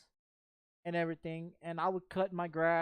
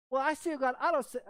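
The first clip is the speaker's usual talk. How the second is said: imitating girlfriend protesting; 6 semitones higher, 3 dB louder than usual.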